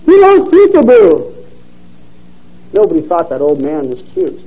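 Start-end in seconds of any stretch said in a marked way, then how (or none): 0.0-1.3 shouting. A narrator's reading. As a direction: holler across the room to a baby. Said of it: "the man began shouting from across the room".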